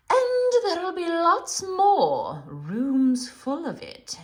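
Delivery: slow, loud, silky witch voice; tempting witch. A narrator's reading. Witch tempting Edmund with sweets, slow and silky. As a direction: lure him in slowly. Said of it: "she tempted him".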